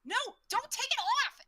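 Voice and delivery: high, loud, urgent cry; alarmed wizard. Acted. Wizard begging the fighter not to remove it, alarmed cry. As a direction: yell a panicked warning. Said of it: "you hear the wizard".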